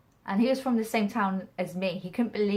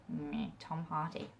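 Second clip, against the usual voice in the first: a slow, much softer unimpressed shrug of a line; dismissive meh; 2 semitones lower, 9 dB quieter than usual. Retold or imitated. Eye-rolling at Tom Hardy while watching, mildly dismissive. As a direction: brush him off, a touch bored. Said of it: "I'm just sort of like".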